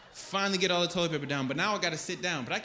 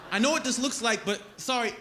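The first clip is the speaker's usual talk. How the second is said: flustered denial; 7 semitones higher than usual. Retold or imitated. Flustered denial to staring driver.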